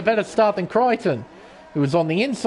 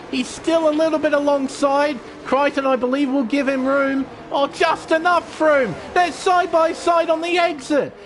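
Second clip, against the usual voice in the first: excited, higher race play-by-play; motorsport commentator; 6 semitones higher than usual. Acted. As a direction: call the race live, pushing the energy.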